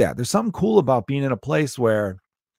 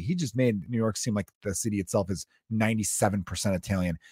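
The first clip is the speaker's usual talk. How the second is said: ordinary talk, nothing marked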